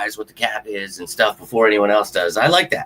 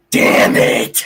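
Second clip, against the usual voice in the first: loud, exasperated curse; pissed-off fan venting loudly; frustrated outburst; 7 dB louder than usual. Real, not acted. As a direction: blurt it out in frustration. Frustrated outburst about the lost game.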